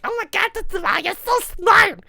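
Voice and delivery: put-on high, fast girly gush; imitating Amy. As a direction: gush like a starstruck girl. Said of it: "Amy were like".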